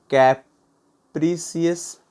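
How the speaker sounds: कैप्रिसियस very slow, broken into separate syllables. Shown as a demonstration. Teacher giving learners a word to note down, कैप्रिसियस syllable by syllable. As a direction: say कैप्रिसियस in pieces with gaps between.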